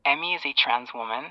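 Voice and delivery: retold confession, quiet and thin down the line; phone call. Boyfriend telling his mom about his girlfriend, quiet on the phone. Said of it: "then I heard him say".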